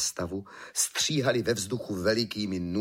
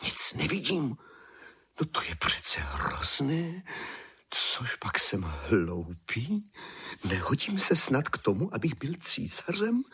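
dismayed thought; 5 semitones higher than usual. A narrator's reading. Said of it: "myslel si císař".

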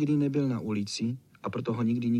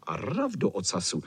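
ordinary delivery

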